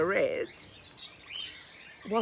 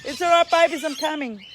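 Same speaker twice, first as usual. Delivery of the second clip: louder and higher, calling to the birds; 7 semitones higher, 13 dB louder than usual. Real, not acted. Owner calling to her parrots, loud and higher. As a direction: call across the house to reassure the birds.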